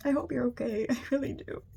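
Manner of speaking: fighting back tears while wishing them well; on the verge of tears. Real, not acted. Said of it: "I wanna cry".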